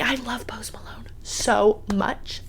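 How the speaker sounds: slow, soft secret whisper. Real, not acted. Fan gushing about her favorite artist, whispered slowly. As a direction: whisper it like a fond confession. Confessing love for [name].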